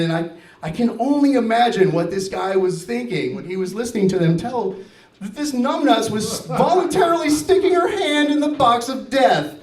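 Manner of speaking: normal talking voice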